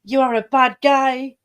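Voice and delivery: half-joking sympathy; mock pity. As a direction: talk to him like a sad puppy. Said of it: "I'm just like".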